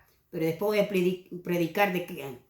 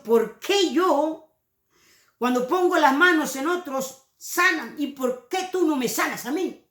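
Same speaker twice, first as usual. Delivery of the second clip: higher, drawn-out mild plea; anguished questioning; 5 semitones higher than usual. Acted. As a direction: question God in raised, stretched tones. Voices sick preachers pleading with God.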